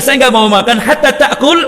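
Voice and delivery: laughing quote. Retold or imitated.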